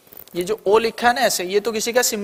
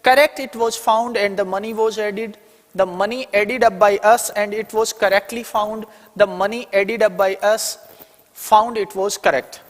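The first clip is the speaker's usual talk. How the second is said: reading the options very slowly; slow reading for students to follow; slow dictation; much slower than usual. Shown as a demonstration. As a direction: dictate each English word at x0.3 pace. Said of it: "क्या passive बनेगा".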